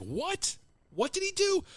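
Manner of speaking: very high-pitched incredulous question; mock disbelief. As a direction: imitate a crew's stunned reaction. Everyone reacts in disbelief.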